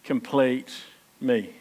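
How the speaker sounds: a touch of tender Jerry; tender movie line. Retold or imitated. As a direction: say it like a heartfelt confession. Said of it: "he comes in, and he says".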